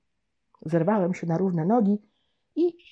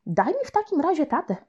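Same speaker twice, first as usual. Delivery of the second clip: grandma asking; 8 semitones higher than usual. Acted.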